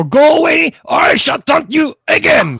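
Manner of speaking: put-on high, loud shouted French accent taunt; mock French taunt. Host hams up a French guard taunting from the tower, shouting high. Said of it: "that was a brilliant French accent".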